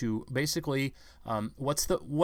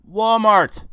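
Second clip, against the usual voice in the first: a loud, high shout of Walmart; ranting host shouting out the answer; shouted punchline; 7 semitones higher, 13 dB louder than usual. Real, not acted.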